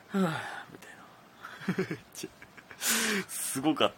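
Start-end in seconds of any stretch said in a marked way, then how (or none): 0.1-2.0 soft breathy sigh out; relieved exhale. Retold or imitated. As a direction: breathe out like it's over. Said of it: "って何発かやってから". (一息つくようなため息)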